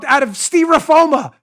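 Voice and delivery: "styrofoam" said "stee-ro-FO-ma", high and loud; mock-Spanish word. Host retells his botched translation, "styrofoam" as loud "steerofoma". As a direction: blurt "styrofoam" Spanish-style as "steeroFOma", drawn out. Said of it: "I translate directly".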